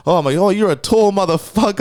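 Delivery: high, awed blurt; amazed. Retold or imitated. Telling a giant he is tall, high awed. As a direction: marvel out loud at him. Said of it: "I literally said this to him".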